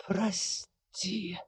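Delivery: soft, high hoarse farewell. Acted. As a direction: rasp it out faintly. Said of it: "прохрипел".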